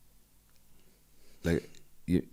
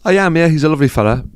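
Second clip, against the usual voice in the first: much higher, louder off-mic answer; louder take; 9 semitones higher, 12 dB louder than usual. Real, not acted. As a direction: call it out from away from the mic. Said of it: "you need to get your face in for the clips".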